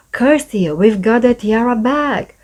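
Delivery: usual speaking voice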